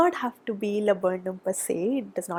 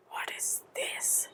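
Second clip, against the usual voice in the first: whisper; 13 dB quieter, slower than usual. Real, not acted. Puzzled at bad card.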